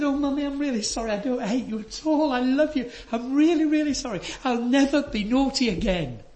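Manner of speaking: a hint of a tearful child saying sorry; little boy apologising.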